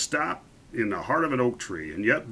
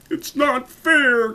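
put-on high, drawn-out childish wail; tantrum cry; 14 semitones higher, 6 dB louder, much slower than usual. A narrator's reading. Young duke after all his arrows fail, tantrum cry. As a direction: whine it loud, stretching the words. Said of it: "cried the Grand Duke".

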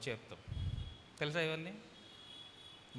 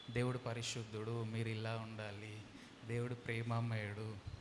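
softer, lower, slow gentle preaching; slow and calm; 5 semitones lower, 4 dB quieter, slower than usual. Shown as a demonstration. Pastor showing the gentle style people expect, slow and soft. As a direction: ease off into a calm, quiet sermon tone. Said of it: "మెల్లగా కొంచెం ప్రశాంతంగా".

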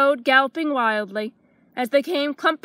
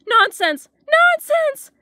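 high, loud frightened refusal; alarmed protest; 12 semitones higher, 6 dB louder, slower than usual. A narrator's reading. Dragon refusing to meet Guy's father, loud and scared. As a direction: shout it down in alarm. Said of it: "said the dragon".

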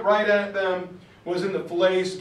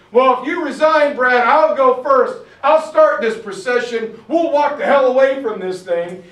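loud, raised voice meant to carry; Patton loud in background; 4 semitones higher, 8 dB louder than usual. Retold or imitated. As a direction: call it out so the whole staff hears. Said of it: "in the background loud enough".

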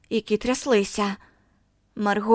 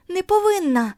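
crying out; 9 semitones higher than usual. A narrator's reading. Girl refusing the shower, crying out повинна. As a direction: push повинна up into a desperate cry. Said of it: "вона кричала".

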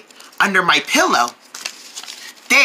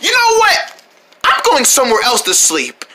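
sudden high shout on know; exasperated yell at the bed hog; fed-up outburst; 3 semitones higher than usual. Acted. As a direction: blow up in frustration. Fed-up sibling blowing up.

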